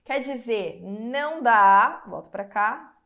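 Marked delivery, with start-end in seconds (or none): 0.8-2.0 não dá said slowly and much louder; emphatic no. Shown as a demonstration. Teacher insisting to students, slow and loud. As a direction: lean hard on não dá. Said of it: "teacher stresses rule".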